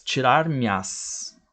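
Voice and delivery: slow "tirar-me-as" with me and as fused into myas; elided. Shown as a demonstration. Teacher showing elision, "tirar-me-as" slowly with myas as one syllable. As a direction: stretch "tirar-me-as", joining the last two syllables as one. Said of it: "virar uma só".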